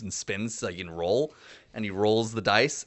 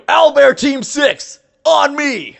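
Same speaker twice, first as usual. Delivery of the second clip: much higher and louder rally call; commanding shout; 14 dB louder, much slower than usual. Acted. Squad leader calling his team over the radio, shouting. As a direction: bark the team's name.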